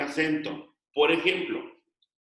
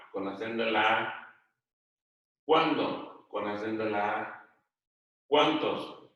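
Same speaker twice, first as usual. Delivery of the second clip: each word lifted, then its spelling note lower; classroom dictation; 4 semitones lower than usual. Real, not acted. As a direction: dictate a word list item by item, pausing for copying. Teacher dictates word list.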